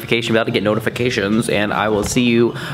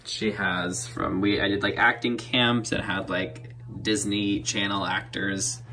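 hushed voice; 7 dB quieter than usual. Real, not acted. Mom asleep, talking hushed.

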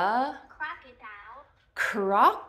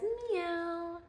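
soft meow; 6 semitones higher, 7 dB quieter than usual. Retold or imitated. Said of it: "The cat says".

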